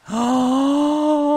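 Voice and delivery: long, high, loud "oh" of amazement; amazed gasp. Real, not acted. Player amazed by the skeleton horse, high drawn-out gasp. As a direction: let out a drawn-out high cry of wonder. Sees the horse still there.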